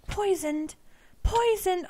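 loud, high alarmed cry; alarmed shout. Real, not acted. Player discovering she is poisoned, loud alarm. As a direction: shout the news in panic.

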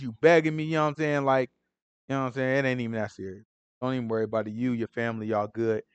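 low, soft, easygoing forgiveness; calm him down like a big-hearted boss; reassuring master. Retold or imitated. Master tells kneeling servant, low and soft, don't worry.

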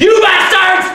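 high, loud enraged yell; furious scream. Acted. Cecchino grabs a shovel and screams at the soldiers: raging high yell. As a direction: scream it at the soldiers with all you have. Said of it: "and I screamed".

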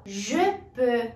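"Je peux" with rounded eu vowel; "Je peux" said slowly as a model; teacher's model. Shown as a demonstration. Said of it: "exemplos de palavras com essa vogal".

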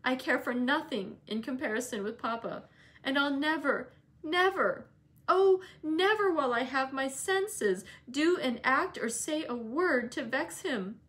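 put-on young Cathy, earnest and pleading, voice rising on never; a girl swearing devotion to her papa; girl's earnest plea. Young Cathy vowing to Ellen, rising and earnest.

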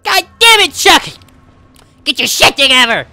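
shrieking high furious yelling. Acted. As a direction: scream at him in rage. Yelling Rugrats scene.

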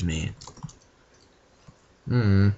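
ordinary talk, nothing marked

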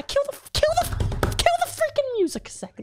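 very high, panicked squawk; frantic squeal. Acted. Frantic plea to kill the music.

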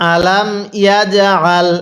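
slow "আলাম ইয়াজআল", bounced "j"; model recitation. Shown as a demonstration. Teacher has learners read along, slow measured "আলাম ইয়াজআল". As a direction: draw out "আলাম ইয়াজআল" syllable by syllable. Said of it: "আমার সাথে সাথে পড়বেন".

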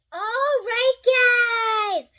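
high, loud upbeat address to viewers; rally the viewers with energy; excited outro. Real, not acted.

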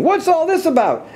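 very high, excited demand; high-pitched storybook shout; crowd shouting. A narrator's reading. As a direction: call out in amazed confusion. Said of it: "the people came shouting".

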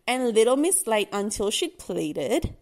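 ordinary delivery

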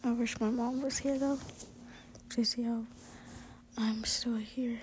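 put-on whispered reassurance to a frightened girlfriend. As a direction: coax her to speak, gently whispering. Boyfriend cuddling and reassuring listener.

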